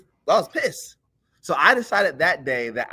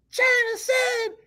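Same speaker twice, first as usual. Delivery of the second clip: very high, drawn-out mock holler; imitated mom's yell; slower than usual. Mom calling her son in from outside, high imitated yell. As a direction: slowly call a child indoors in a high mom voice.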